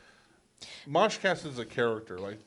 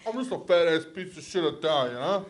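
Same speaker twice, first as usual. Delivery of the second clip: louder, higher mock New York Italian; Italian-American accent; 5 semitones higher, 6 dB louder than usual. Riffing for a laugh as an Italian guy, mock Italian-American accent. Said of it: "That ass fucking Italian".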